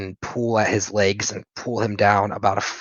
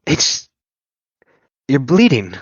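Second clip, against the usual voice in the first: louder frightened outburst; alarmed cry; 5 semitones higher, 8 dB louder than usual. Acted. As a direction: blurt it out in panic. Nico alarmed, friend is bleeding.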